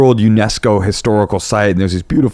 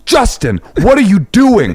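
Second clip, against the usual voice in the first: high, loud telling-off; pitched-up outburst, as to a naughty kid; scolding a child; 11 semitones higher, 4 dB louder than usual. Retold or imitated. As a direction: reenact a shocked parent's reprimand. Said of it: "as if it was a child".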